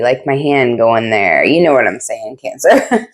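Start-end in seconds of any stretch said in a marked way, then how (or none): 2.4-3.1 name rising into a laugh. Real, not acted. Tarot reader laughing in sympathy with fellow Cancers, laughing. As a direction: break into a knowing laugh. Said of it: "I'm not laughing at you".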